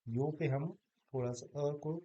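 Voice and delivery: ordinary delivery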